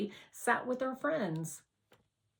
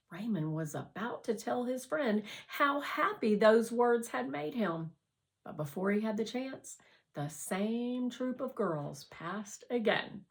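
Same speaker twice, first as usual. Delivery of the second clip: plain reading voice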